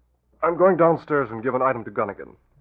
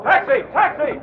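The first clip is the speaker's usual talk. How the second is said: raised call for a cab; 6 dB louder than usual. Acted. Reed calling for a taxi to chase, loud. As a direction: shout after the car.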